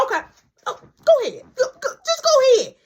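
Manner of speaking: high, loud exasperated yelp; mock-frantic urging; acted fed-up surrender. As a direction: give in with hammed-up impatience.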